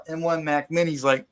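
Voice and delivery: usual speaking voice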